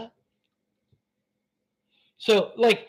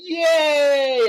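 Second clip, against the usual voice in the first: sudden loud hammed-up yay; shouted mock cheer; 4 semitones higher, 5 dB louder than usual. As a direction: cheer it out like a fake celebration. Mock cheering through history.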